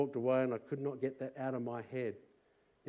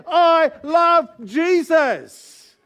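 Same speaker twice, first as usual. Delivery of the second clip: shouted joyfully, much higher and louder, very slow; loud declaration; 21 dB louder, much slower than usual. Shown as a demonstration. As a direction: proclaim it at full voice. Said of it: "with a loud voice and say".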